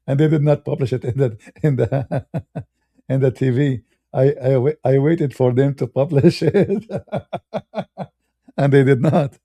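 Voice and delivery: amused, words broken up by real laughter; can't hold back the laughs; laughing while telling. Real, not acted. Laughing at his own anecdote.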